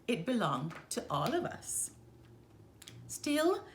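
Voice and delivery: ordinary delivery, nothing marked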